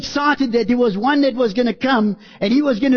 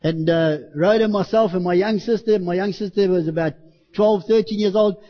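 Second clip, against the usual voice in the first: usual speaking voice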